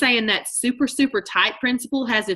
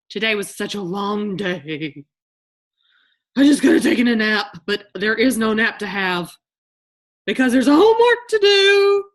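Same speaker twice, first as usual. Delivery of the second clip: whiny complaint; 5 dB louder than usual. Acted. Mock tired self-talk.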